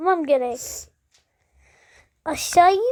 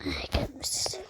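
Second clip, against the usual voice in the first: whisper; 3 semitones lower, 13 dB quieter than usual. Real, not acted. Varışta fısıltıyla.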